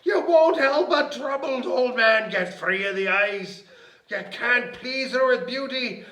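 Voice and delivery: loud, high indignant leprechaun; hammed-up aggrieved outburst; angry growl. A narrator's reading. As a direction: scold her in a little man's temper. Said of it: "he growled".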